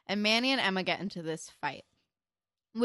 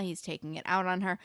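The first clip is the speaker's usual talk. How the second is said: ordinary delivery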